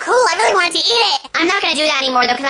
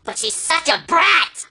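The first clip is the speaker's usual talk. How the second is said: ordinary delivery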